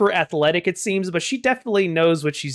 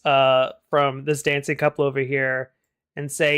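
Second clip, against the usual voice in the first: ordinary delivery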